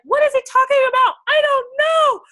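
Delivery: retold frustrated shout from chemistry class; frustrated outburst. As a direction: yell out the confusion. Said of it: "Whenever I would get frustrated".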